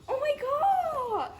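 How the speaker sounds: high, swooning wail; real overwhelmed fan squeal; fangirl gushing. Real, not acted. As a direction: gush over him in a high whine. Gushing over handsome idol card.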